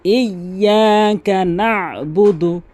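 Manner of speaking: chanted না'বুদু with a squeezed throat stop after না; pressed ayn. Shown as a demonstration. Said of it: "এখানে চাপ দিয়ে". (না'বুদু-তে আইনে চাপ দিয়ে)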